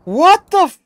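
very high, loud yelling; outraged disbelief. Real, not acted. Gamer discovering he missed EMF five, outraged yell. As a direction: scream at the screen in disbelief.

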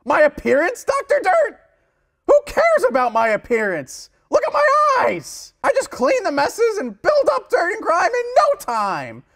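high incredulous outburst; outraged. Acted. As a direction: burst out in disbelief. Sponge outraged question.

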